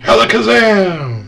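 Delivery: high, loud magic word; genie. Acted. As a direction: cast the spell with a flourish. Genie casts a spell.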